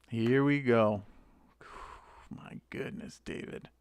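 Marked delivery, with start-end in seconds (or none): 1.6-3.7 whispered dismay. Real, not acted. Sighing at a disappointing card.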